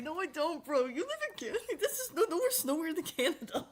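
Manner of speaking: high laughing mock voice; quoting the video guy. Retelling the video's Canada joke in a high laughing voice. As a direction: quote him high, through laughter. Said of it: "He's like".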